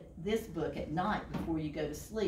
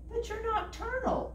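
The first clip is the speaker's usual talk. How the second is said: indignant protest. A narrator's reading. Bird protesting.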